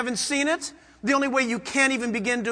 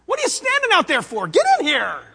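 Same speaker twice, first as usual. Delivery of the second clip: higher, louder, eager calling in; God calling a sinner in from the porch, eager welcome; 6 semitones higher, 4 dB louder than usual. Acted. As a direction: wave them in urgently, warm and insistent. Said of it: "God says".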